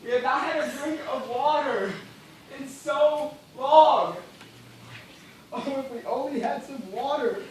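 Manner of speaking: anguished thirsty cry; desperate plea. Acted. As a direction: beg the empty sky for water. Thirst-mad plea for water.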